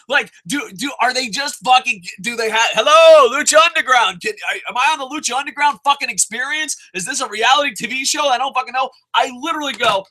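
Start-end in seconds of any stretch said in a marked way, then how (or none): 2.7-8.9 excited rant. Retold or imitated. Mock call to the show.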